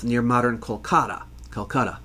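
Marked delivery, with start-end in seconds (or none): none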